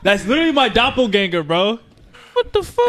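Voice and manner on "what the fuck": much higher, stunned what the fuck; shocked cry. Real, not acted. Friend sees his own lookalike, shocked high yelp. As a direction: yelp in disbelief.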